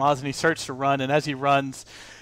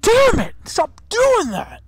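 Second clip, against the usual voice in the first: high, loud, slow pained yelp; pained auctioneer yelp; 9 dB louder, slower than usual. Acted. GM as the punched auctioneer, a high loud yelp. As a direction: yelp it out like a man just punched in the nose. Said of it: "He goes".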